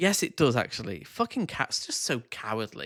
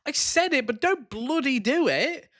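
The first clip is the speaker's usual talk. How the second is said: mock sarcastic; 11 semitones higher, 4 dB louder than usual. Mimicking her hypocrisy.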